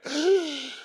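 sharp high gasp for air; relieved gasp. Retold or imitated. Miner reliving escape from the fire, gasping. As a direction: gulp air like surfacing from water. First breath of fresh air.

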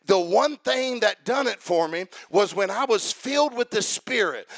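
ordinary delivery

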